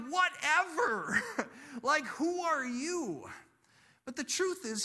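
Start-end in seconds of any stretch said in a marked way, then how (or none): 0.1-3.3 high, loud, drawn-out brush-off; mock dismissive. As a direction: play a scoffing comeback with a laugh. Imagined scornful retort by Elijah.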